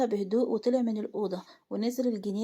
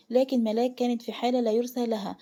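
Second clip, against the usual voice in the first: ordinary delivery, nothing marked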